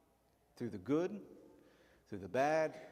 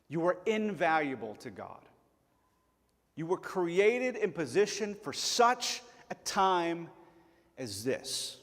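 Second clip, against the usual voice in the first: louder, slow, higher insistence; building preacher emphasis; 4 semitones higher, 4 dB louder, slower than usual. Acted. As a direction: lift it to the climax. Sermon climax.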